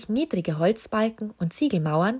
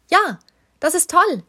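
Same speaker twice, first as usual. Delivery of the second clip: excited; 11 semitones higher, 7 dB louder than usual. A narrator's reading. Susi spots the chandelier cranks.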